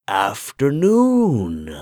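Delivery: creepy shopkeeper calls a greeting; hoarse old man calling. A narrator's reading. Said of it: "called out in a hoarse voice".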